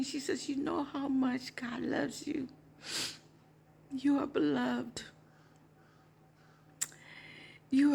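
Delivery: moved, softly holding it together; real emotion welling up, with long pauses; getting emotional. Real, not acted. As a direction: tell it tenderly while choking up a little. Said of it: "I'm getting emotional".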